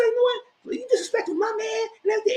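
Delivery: high squeaky imitation of a woman snapping back; shrill mimicked defensive retort; mock shouty reply. Retold reality show argument.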